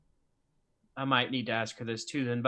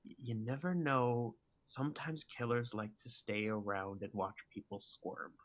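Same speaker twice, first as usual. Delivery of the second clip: leaning in close, hushed and softer; creepy whisper; 11 dB quieter than usual. Acted. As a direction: drop to a sinister confidential murmur. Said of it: "creepy thing to whisper to someone".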